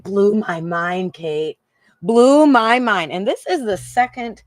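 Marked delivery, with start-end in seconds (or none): 2.0-3.1 emphatic repeat. Real, not acted.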